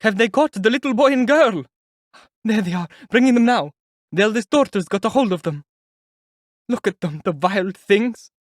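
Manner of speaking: high, loud excited woman; a nosy woman pointing at the children; gossiping onlooker. A narrator's reading.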